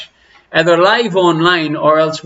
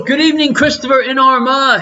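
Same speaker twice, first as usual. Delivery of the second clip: sing-song greeting; 10 semitones higher than usual. Acted.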